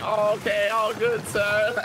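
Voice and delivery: high mocking sarcastic voice; high, exaggerated sarcastic imitation. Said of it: "He had a little sarcasm voice".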